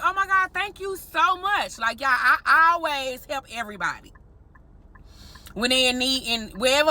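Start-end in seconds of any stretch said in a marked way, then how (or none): 0.0-1.8 grateful. Retold or imitated. Thanking stranger who paid.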